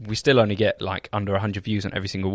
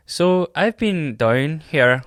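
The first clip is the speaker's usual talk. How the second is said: slow, higher-pitched Irish accent; lilting Irish impression; 5 semitones higher, much slower than usual. Shown as a demonstration. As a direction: put on an Irish accent, drawn out.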